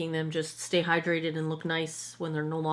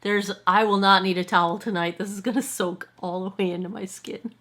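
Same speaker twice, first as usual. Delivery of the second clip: ordinary talk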